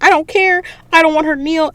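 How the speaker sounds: high-pitched, insistent objection; mock exasperated outburst; retold defiant protest. Retold defiance as Arthur is mad.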